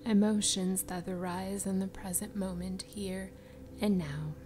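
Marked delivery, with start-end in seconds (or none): none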